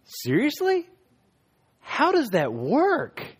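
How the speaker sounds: disbelief, slowly and loudly; incredulous. Retold or imitated. Preacher retells asking the half Wiccan girl in disbelief. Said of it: "And I was like".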